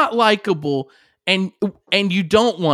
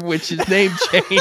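usual speaking voice

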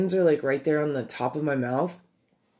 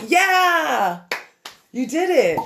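high, loud cheering; excited cheer; 12 semitones higher, 5 dB louder, much slower than usual. Real, not acted. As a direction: cheer her on loudly. Cheering the bottle opened.

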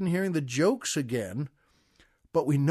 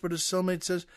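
ordinary delivery

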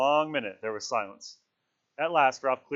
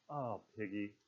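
soft, stricken sigh of words; quietly appeal, heavy with dismay; low and stricken; 2 semitones lower, 10 dB quieter than usual. A narrator's reading. Ralph appeals to gesticulating Piggy, low and stricken. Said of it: "low and stricken".

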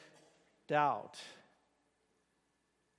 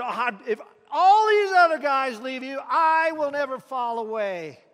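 louder, cocky declaration; arrogant boast; 4 semitones higher, 8 dB louder than usual. A narrator's reading. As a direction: brag it boldly to the room. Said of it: "in all his arrogance and boldness".

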